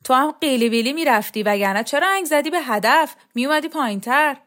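high, loud, swooping jibe; taunting retort. Acted. Mocking retort in a quarrel.

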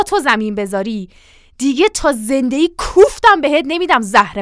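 loud, scolding ultimatum; angry threat. Acted. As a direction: lay down the law, forcefully. Mother threatens the servant.

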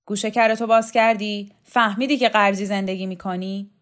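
loud, menacing scolding; glaring threat. Acted. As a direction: glare and lay down the threat. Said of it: "چشم قره رفت".

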